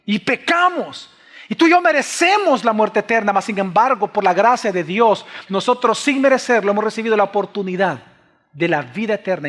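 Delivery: high, loud impassioned sermon delivery; fiery preacher at full volume; full-force preaching. Acted. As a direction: drive it home to the congregation, loud and high.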